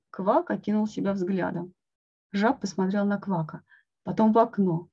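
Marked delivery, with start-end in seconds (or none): none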